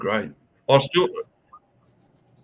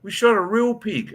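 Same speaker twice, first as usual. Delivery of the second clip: lightly voiced wife; 9 semitones higher than usual. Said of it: "And she goes".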